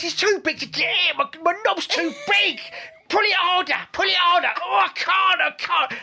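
put-on high cockney kid, straining; film boy pushing, high cockney. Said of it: "He goes all cockney".

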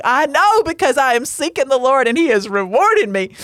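overjoyed at getting the Brussels sprouts; excited. Retold or imitated. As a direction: blurt it out thrilled. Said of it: "you're a little too excited".

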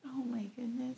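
whisper. Real, not acted. Whispered dismay at prompt.

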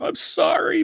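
high-pitched little sorry; apologetic. Real, not acted. Host apologizing for a missed music cue, high-pitched apology.

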